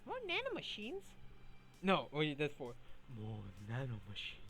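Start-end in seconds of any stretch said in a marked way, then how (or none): 0.1-1.0 "More nanomachines?" asked in a much higher put-on voice; high squeaky "More nanomachines?"; high mock question. Voicing the news item. 3.2-4.4 "More nanomachines." stated in a much lower put-on voice; low, grim "More nanomachines."; low mock statement. Voicing the news item.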